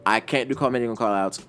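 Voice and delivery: plain reading voice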